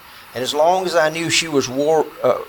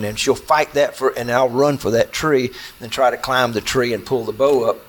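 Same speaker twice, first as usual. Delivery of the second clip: usual speaking voice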